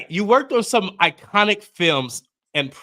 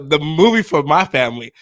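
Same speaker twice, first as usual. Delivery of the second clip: normal talking voice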